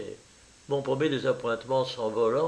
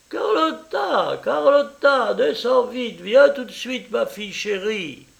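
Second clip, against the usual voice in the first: calling up the stairs; 10 semitones higher, 5 dB louder than usual. Acted. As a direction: call her down from the floor above.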